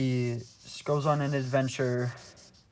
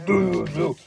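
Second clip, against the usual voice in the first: loud, higher grumbling dismissal; hammed-up fatherly refusal; mock refusing dad; 5 semitones higher, 5 dB louder than usual. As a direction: shoo him away loudly, pitched up. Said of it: "his dad was like".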